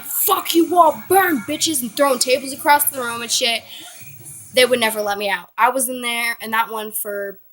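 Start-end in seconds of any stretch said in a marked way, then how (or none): 0.0-1.8 high, drawn-out angry yell; hammed-up rage at everyone; retold furious outburst. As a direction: scream it at the laughing kids and nurses. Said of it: "I'm like".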